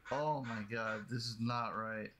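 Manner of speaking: ordinary talk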